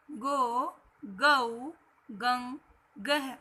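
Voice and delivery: "ग" series one by one, each vowel held out; syllable recitation. Shown as a demonstration. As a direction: drill "ग" to "गह" with a pause after each syllable. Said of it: "उसका उच्चारण इस प्रकार होगा". ("ग" से "गह" तक अलग-अलग खींचकर उच्चारण)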